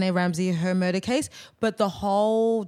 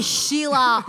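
ordinary delivery

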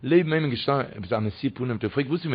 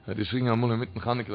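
normal talking voice

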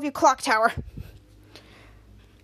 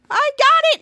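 high, loud acted glee; excited gloating; 11 semitones higher, 8 dB louder than usual. Piper after the prank, high loud crowing. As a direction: crow it triumphantly.

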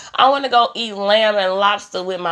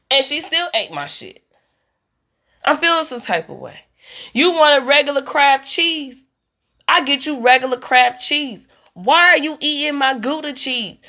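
usual speaking voice

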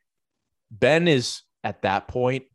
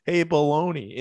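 calm but angry; 7 semitones higher, 4 dB louder than usual. Retold or imitated. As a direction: say it calm with anger underneath. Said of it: "he said it so calm".

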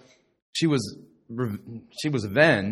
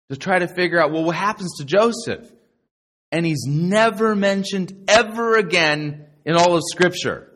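ordinary talk

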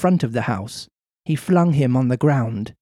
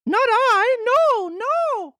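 much higher boy voice, a mock sob; Pinocchio sobbing; slower than usual. Pinocchio denying to the farmer, mock sobbing. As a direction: plead like a crying puppet. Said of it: "cried Pinocchio, sobbing bitterly".

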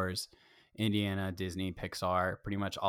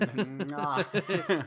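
kiss sound; 7 semitones higher than usual. Acted. Blowing a kiss to Luke.

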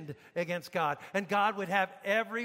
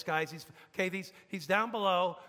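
ordinary talk

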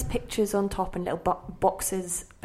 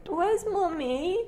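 put-on much higher, slow small-child voice; childlike question; 10 semitones higher, much slower than usual. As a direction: ask innocently like a lost little girl.

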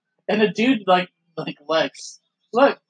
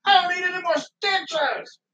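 mock angry wrestler; 12 semitones higher, slower than usual. Voicing wrestler refusing stitches.

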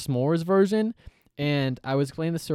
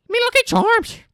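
much higher, loud mock Irish leprechaun; leprechaun Irish accent; 8 dB louder than usual. Host voicing the Lucky Charms leprechaun, mock Irish accent. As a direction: ham up the cereal-box Irish. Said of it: "this is how this man sounds on the cover".

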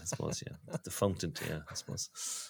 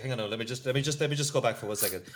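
ordinary talk

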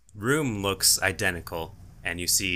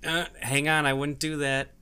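gnoll voice; 8 semitones higher than usual. Acted.

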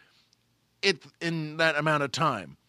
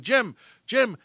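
higher and louder call down the hall; 6 semitones higher, 6 dB louder than usual. Retold or imitated. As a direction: shout the name to fetch him. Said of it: "he started calling for Jim McCauley".

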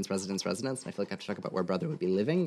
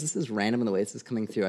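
ordinary delivery

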